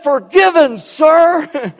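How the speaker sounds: loud, high deadpan cadet shout; shouted reply. Retold or imitated. Cadet answering for the trash can, loud shout. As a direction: shout it straight-faced.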